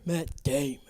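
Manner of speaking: lightly voiced puppet impression, laughing; Matt Damon puppet. Guest echoing the host's Team America joke, light puppet voice. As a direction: echo the silly name-call through a laugh.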